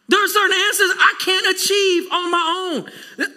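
rising, ringing pulpit delivery; preaching at full force. Acted. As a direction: build it like the sermon's peak. Preaching climax.

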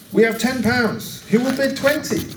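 high-pitched auctioneer calling bids; lifted, projected auctioneer's call. A narrator's reading. Auctioneer calls the bid.

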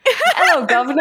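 high, hammed-up Cockney British accent; mock British accent. Co-host demonstrating British for the announcer, laughing mock accent. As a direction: squeal it in a silly British accent. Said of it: "Say it in a British accent".

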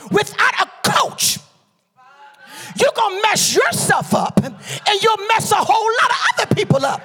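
high, loud, driving preacher delivery; full-force preaching. Real, not acted. Preacher warns congregation about ministry without a coach, shouting fervently. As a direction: drive it home to the whole congregation.

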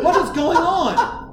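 slow, laughing and high; laughing disbelief. Real, not acted. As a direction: laugh through the question.

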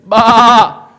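loud, drawn-out "baaah"; sheep bleat. Acted. Preacher mimicking a sheep that refuses to lie down, loud bleat.

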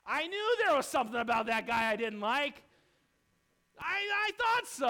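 a churchgoer claiming he always knew the possessed man was trouble; smug churchgoer. Retold or imitated. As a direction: say it like a gossip who was always sure. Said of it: "there were some people".

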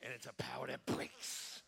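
breathy hushed whisper on breaks. Shown as a demonstration. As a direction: drop it to a whisper. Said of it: "Everybody go".

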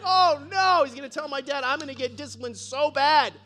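high, loud frantic worry; hammed-up dread of dad; mock panic. Boy panics that Charlie will tell his dad, loud. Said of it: "I'm like".

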